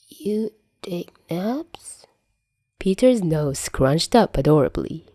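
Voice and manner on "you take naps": hushed question. Acted. Drugged Peter asks Tony.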